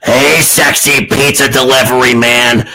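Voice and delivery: hammed-up seductive woman; put-on come-hither voice; sultry customer. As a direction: play a mock porn seductress.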